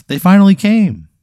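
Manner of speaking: put-on high, loud happy child's cry; overjoyed kid. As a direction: squeal it like a kid getting a treat. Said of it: "He looks so happy".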